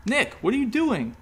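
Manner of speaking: high, shocked cry; alarmed, stop him now; a gasp of alarm. A narrator's reading. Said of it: "Kendra gasped".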